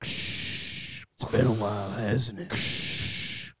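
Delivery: gruff movie hero. Pretend. Mock movie dialogue.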